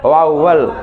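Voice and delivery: "वाउवल" said low and deliberate as "vauvel"; correct vowel. Shown as a demonstration. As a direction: model "वाउवल" carefully for the class. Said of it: "वाउवल बोलने आएगा ना".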